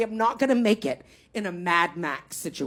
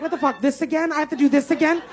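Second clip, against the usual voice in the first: exasperated complaint; 6 semitones higher than usual. Acted. Acted exasperation at the shower.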